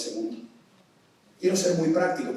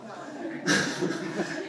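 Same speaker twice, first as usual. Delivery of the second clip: 1 laughing; 6 semitones higher, 4 dB quieter than usual. Real, not acted. Audience laughter reaction.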